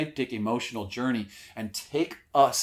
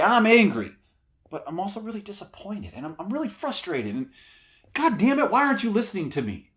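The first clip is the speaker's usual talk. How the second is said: layered anger; 8 semitones higher, 4 dB louder than usual. Shown as a demonstration. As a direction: shift from anger to hurt frustration to exploding. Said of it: "You can come in and say".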